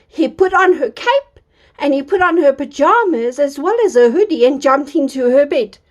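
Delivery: usual speaking voice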